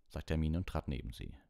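ordinary delivery

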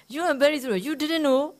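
keeps jeering, pitched high; mocking drinker. Drinker taunting the owner about his rabbit, high mocking voice. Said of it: "Like that".

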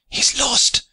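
very high, horrified gasp; high-pitched cry of shock; Terlake's gasp. A narrator's reading. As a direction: gasp it out in dismay. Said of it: "gasped Terlake".

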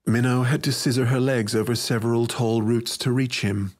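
ordinary delivery

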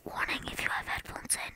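quick hushed aside, no voice; whispered warning. Real, not acted. Speaker warning headphone wearers before a scream, whispered. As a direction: whisper a heads-up to the listener.

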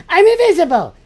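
laughing. Real, not acted. Laughing at disappearing trick.

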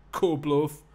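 angry Geordie bruiser, high and loud; big strong Geordie losing his temper; Geordie accent. Acted. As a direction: bellow insults in Geordie. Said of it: "give him a Geordie accent".

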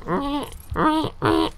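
high, drawn-out owl squeaks; owl impression. Shown as a demonstration. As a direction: imitate a little owl's cries. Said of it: "That's my impression of an owl".

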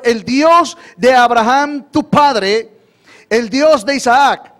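emphatic scripture reading. Real, not acted. Preacher reads verse aloud, emphatic.

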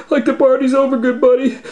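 high, loud scared Shaggy voice; high frightened whine. Acted. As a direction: wail it like a terrified teen. Shaggy scared, haunted house.

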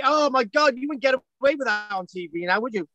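ordinary delivery

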